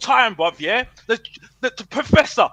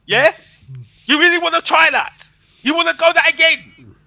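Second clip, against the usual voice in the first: loud, higher challenge; heated challenge; 5 semitones higher, 5 dB louder than usual. Real, not acted. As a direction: throw it back at them, louder. Fan ranting at rivals' claims.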